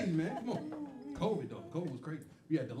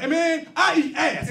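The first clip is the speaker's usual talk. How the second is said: proud booming declaration; 8 semitones higher, 7 dB louder, slower than usual. Retold or imitated. Guy's proud answer, acted.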